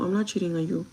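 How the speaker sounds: ordinary talk, nothing marked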